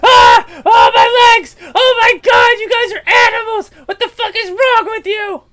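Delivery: very high, very loud pained shriek; an orc wailing at the party; screaming orc in agony. Acted. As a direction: howl over broken legs. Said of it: "He's like".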